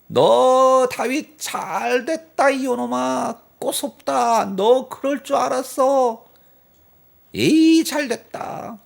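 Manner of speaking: high, loud, slow gloating shout; gloating yell. Retold or imitated. Saul's man shouting at fleeing David, high gloating yell. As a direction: crow over David's fall from far off. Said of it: "소리를 질러요".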